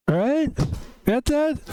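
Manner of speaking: high, pushy mock threat; hammed-up warning, pitched high; mock tough challenge. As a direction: play the candy tough guy. Mock tough warning over candy.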